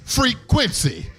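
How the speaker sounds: high, slow hype announcement; DJ name call. Shown as a demonstration. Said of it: "I gotta say it like that".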